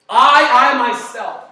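"I" shouted loud and high; stress on I myself. Shown as a demonstration. Pastor stressing God's words to congregation, loud. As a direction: hit "I" hard then "myself". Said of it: "And that word".

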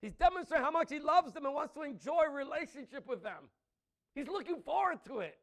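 loud, rising preacher's declamation; preaching at full force. Acted. Preacher proclaiming God's love to the congregation, full force.

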